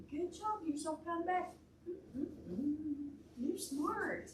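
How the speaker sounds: bright praise. Real, not acted. Praising a child.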